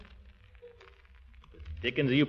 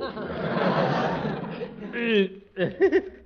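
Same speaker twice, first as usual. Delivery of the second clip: embarrassed laugh; 8 semitones higher than usual. Acted. Gildersleeve's awkward laugh.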